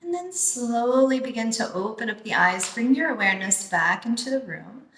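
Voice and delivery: guided meditation. Acted. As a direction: ease them into calm breathing, unhurried. Leads a guided breathing meditation.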